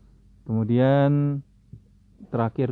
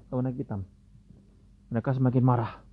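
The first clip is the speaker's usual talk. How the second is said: ordinary delivery, nothing marked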